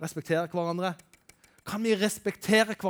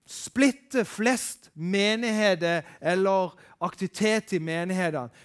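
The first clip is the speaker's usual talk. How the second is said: ordinary talk, nothing marked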